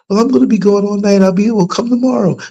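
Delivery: fast, high, insistent neighbor voice; pushy neighbor. Retold or imitated. Neighbor pressing to see the baby, quick and high. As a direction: rush it like she won't take no.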